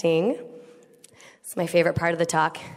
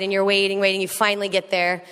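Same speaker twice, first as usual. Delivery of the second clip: normal talking voice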